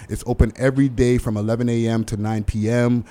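ordinary talk

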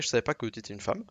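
ordinary delivery

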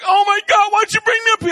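high, loud panicked cry; panicked son. Retold or imitated. Son scared atop the ski slope, panicked high cry. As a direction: shriek it like a terrified kid on a cliff.

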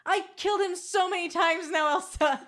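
exasperated. Real, not acted. Exasperated protest about killing Jack.

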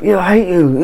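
a high, put-on sneering troll voice; mock internet troll. Online commenter snapping at a stranger, mock spiteful high voice. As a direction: toss it off like a spiteful commenter, half in jest. Mocking online arguers spending hours.

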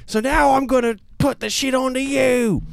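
high, loud barking orders; angry boss. Pretend. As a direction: order him into the office, furious. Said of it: "Is the sergeant played by Gordon Ramsay?".

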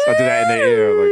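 high siren wail with a laugh; siren noise. Retold or imitated. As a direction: wail like the Kill Bill siren. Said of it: "that Kill Bill siren noise".

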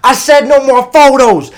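high, loud furious yell. Retold or imitated. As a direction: scream it at the cameraman. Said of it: "He's like".

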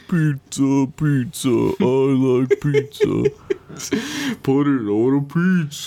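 goofy slow character voice; hammed-up pizza guy chant; silly pizza-man voice. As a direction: play a dopey pizza-loving guy.

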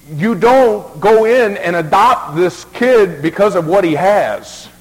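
loud, high and slow, pounding each word; preaching at full force. Acted. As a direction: drive the point home to the pews.

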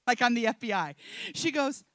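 ordinary talk, nothing marked

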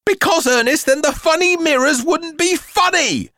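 high, loud exasperated mother; high-pitched outburst of frustration. A narrator's reading. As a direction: burst out at your son, fed up. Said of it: "The woman was exasperated now".

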